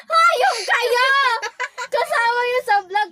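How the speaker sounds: loud, high laughing yell; laughing shout. Real, not acted. Laughing at arguing pair.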